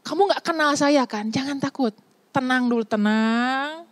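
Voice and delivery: calm them down tenderly; a hint of a soothing Jesus; gentle reassurance. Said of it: "dan bilang".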